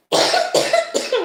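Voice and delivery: real harsh coughing fit after laughing; asthma coughing fit. Real, not acted. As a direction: cough through a laugh-triggered asthma attack. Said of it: "I go into asthma attacks".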